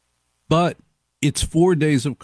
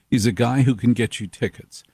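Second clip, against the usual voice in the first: normal talking voice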